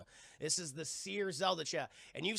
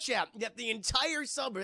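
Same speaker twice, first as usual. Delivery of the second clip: ordinary talk, nothing marked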